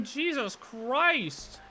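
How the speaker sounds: drawn-out exasperation. Real, not acted. Exasperated at the flooding.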